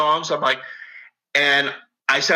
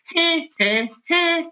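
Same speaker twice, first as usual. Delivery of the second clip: a higher mimicked mother mocking metal; imitating his mother; 7 semitones higher than usual. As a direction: scoff like mom does at the noise. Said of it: "she's like".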